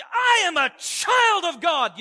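loud, high, slow proclamation; shouted declaration. Retold or imitated. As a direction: shout it out as a bold claim of faith. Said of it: "she gets a".

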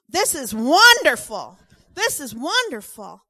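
loud, high, overjoyed burst of relief; excited. Retold or imitated. Oliver bursting with joy to the visiting messenger, loud and excited. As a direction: gush with joy that prayers were answered.

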